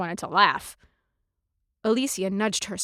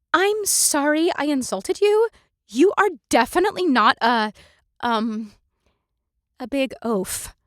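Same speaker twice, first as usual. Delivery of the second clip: higher, halting, nervous voice; nervous apology; 6 semitones higher, 5 dB louder than usual. Acted. Darmonda apologizes awkwardly to the captain, halting and nervous. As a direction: stumble through the apology.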